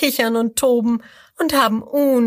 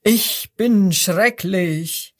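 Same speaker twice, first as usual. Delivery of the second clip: slow, drawn-out menacing brag; a lightly voiced boasting crocodile; scary crocodile boast; much slower than usual. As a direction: say it slowly like a would-be monster.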